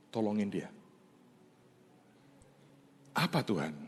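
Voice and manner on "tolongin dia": a soft, hushed voice of God; God's quiet words. Retold or imitated. Tuhan's gentle hushed command. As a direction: pastor quietly quoting God's instruction, hushed. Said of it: "Tuhan ngomong begini".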